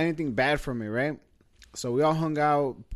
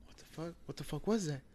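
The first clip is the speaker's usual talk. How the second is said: startled hush; 2 semitones higher, 10 dB quieter than usual. Retold or imitated. Startled by a woman's voice.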